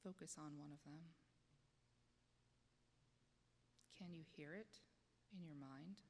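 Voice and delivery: soothing voice that invites the congregation to close their eyes; guided meditation. Acted. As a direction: ease them gently inward, dropping to a whisper at times.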